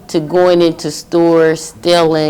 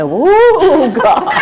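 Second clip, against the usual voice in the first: high, loud startled whoo; a burst of astonished relief at the nuns; surprised whoop; 11 semitones higher, 6 dB louder than usual. Retold or imitated. Woman looking up finding nuns around her, startled whoop.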